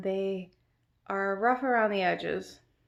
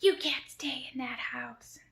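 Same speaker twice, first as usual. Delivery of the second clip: higher, held-back mock yell; imitated scolding scream; 5 semitones higher than usual. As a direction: imitate a distant woman screaming, without full volume. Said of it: "I can hear her screaming".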